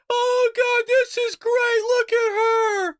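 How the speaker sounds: slow, high, loud mock adoration; mock gushing Democrat. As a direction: gush like a starstruck fan. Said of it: "Democrats will sit there, go".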